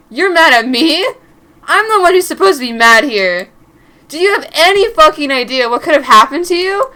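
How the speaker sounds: loud furious shout at her; angry yell. Acted. Angry boyfriend yelling at Mary for coming home late. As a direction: yell it at her the moment she walks in.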